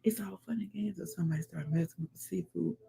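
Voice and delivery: fast, soft whispered aside. Real, not acted. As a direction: whisper it like gossip.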